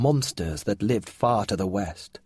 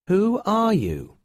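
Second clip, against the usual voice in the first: put-on slow, much higher boy's question; boy asking again; 10 semitones higher, 3 dB louder, slower than usual. A narrator's reading. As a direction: press the stranger, slowly. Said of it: "asked Finn again".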